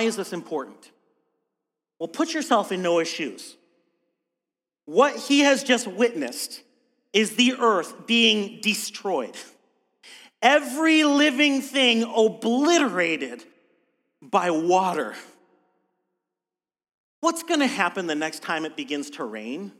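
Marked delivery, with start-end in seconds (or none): none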